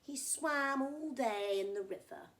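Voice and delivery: ordinary delivery, nothing marked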